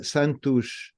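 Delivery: "Santos" said slowly, ending "tush"; slow "Santos" with final o as u and s as sh; slow Portuguese "Santos", sh at the end. Shown as a demonstration. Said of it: "it's a kind of a sh sound".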